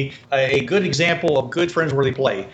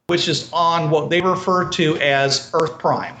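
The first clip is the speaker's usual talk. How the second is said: ordinary delivery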